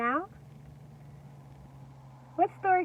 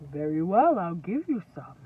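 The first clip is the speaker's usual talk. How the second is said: straw man voice; 6 semitones lower than usual. Acted. Straw seller answering the pig, lower voice. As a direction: lower man's voice.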